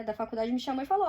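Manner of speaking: ordinary talk, nothing marked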